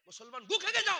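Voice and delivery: furious shouted rebuke at the crowd; scolding yell; angry shout. Real, not acted. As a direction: bark it at them, disgusted. Scolding audience for laughing.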